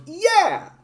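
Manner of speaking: very high squeaky yeah; sidekick cheer. Retold or imitated. Little sidekick cheering on the bully, very high. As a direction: squeak agreement like a toady. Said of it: "the little asshole off to the side that goes".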